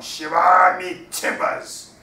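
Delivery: slow, loud hammed-up pirate cry; pirate exclamation. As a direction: bellow it like a stage pirate.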